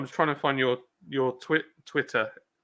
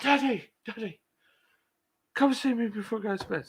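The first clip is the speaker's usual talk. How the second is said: put-on higher, childlike pleading voice; imitating the kids; 8 semitones higher than usual. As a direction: mimic the little ones begging. Kids calling to dad, retold.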